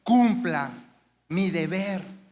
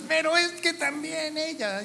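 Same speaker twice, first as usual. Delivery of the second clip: voz aguda imitando al que se excusa; queja defensiva; 9 semitones higher than usual. Retold or imitated. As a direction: acusa de vuelta, más agudo. El otro cónyuge se defiende. (imitando la excusa del otro)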